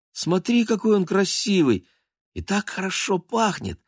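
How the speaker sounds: put-on bright little girl pushing a vase forward. A narrator's reading. Polya offering the cat a flower, high childish voice.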